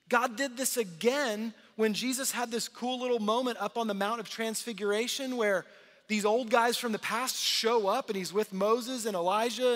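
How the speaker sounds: normal talking voice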